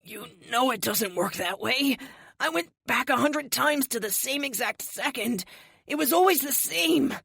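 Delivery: lightly voiced pained man; a hint of a man in pain; through gritted teeth. A narrator's reading. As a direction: push words out through clenched teeth. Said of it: "grimacing and clenching his teeth as he speaks".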